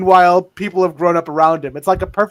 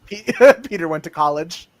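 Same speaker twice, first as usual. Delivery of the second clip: usual speaking voice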